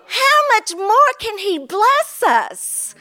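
usual speaking voice

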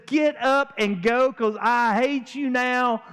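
preacher mocking Amnon's lovesick whining; whiny lovesick Amnon. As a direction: play him as a sulking spoiled prince. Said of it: "looks at her and says".

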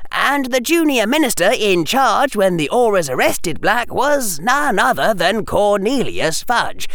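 put-on high-pitched, hesitant boy lecturer; boy explaining eagerly. A narrator's reading. Harry voiced by narrator.

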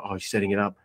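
soft, low murmur; quiet anticipation. Retold or imitated. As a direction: say it softly, noticing. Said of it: "and I saw".

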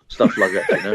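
normal talking voice

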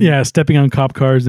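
ordinary delivery

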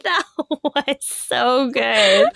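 laughing through the words. Real, not acted. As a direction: crack up mid-sentence. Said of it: "laughing while speaking".

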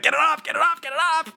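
very high, panicked squeal of words; mock freak-out. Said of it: "he's just freaking the fuck out".

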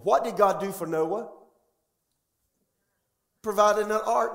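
high, loud shouting preacher climax; full-force preaching. Acted. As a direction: build to a roaring call-and-response peak.

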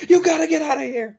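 high panicked yell; shouting. Retold or imitated. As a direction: yell at them to leave.

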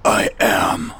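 superhero voice. Acted. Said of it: "That's my superhero voice".

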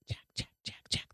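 unvoiced eerie whisper of the name; ghostly whisper. A narrator's reading. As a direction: breathe the name like a spirit in the fog. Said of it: "the voice hissed".

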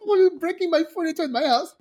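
high, fast imitation of a protest; whiny high-pitched mimicry, rushed; mock dismay. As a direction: play up the cousin's fretting. Mimics cousin upset over furniture.

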